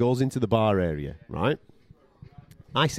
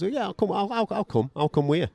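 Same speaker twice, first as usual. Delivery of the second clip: higher, eager voice for a chancer inviting himself; eager freeloader; 10 semitones higher than usual. Retold or imitated. Narrator mimics the mate inviting himself along, high and eager. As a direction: jump at the free drink, pitched up. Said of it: "And he goes".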